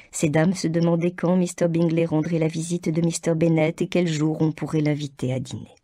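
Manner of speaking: normal talking voice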